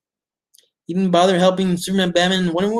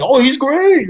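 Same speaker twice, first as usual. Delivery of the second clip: higher, louder sarcastic gush; mocking fans; 8 semitones higher, 7 dB louder, slower than usual. Reviewer mocking everyone praising Joker, sarcastic high gush. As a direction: parrot the praise with scorn. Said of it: "Everyone's saying, like".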